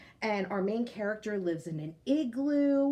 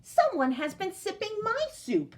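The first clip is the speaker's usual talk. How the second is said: growled; 9 semitones higher than usual. A narrator's reading. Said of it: "she growled".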